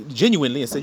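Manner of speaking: ordinary talk, nothing marked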